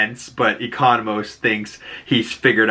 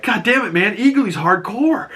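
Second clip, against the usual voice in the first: high-pitched excited exclamation; imitating a thrilled buddy; mock amazement; 10 semitones higher than usual. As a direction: voice an impressed sidekick, pitched way up. Voicing an awed Vigilante cheering eagle.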